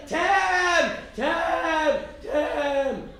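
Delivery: high, loud mock scream for help; screaming a name. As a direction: shriek the name, half-laughing. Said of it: "just screaming".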